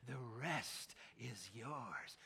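very slow, low and sly; sneaky tempter voice. Shown as a demonstration. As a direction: lure them like a little sneaky person. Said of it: "Like a little sneaky person".

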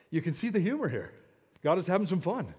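ordinary delivery

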